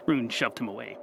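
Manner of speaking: ordinary delivery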